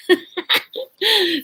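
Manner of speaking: high short laugh at herself; amused laugh. Real, not acted. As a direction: laugh at running over time. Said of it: "I'm almost a half hour".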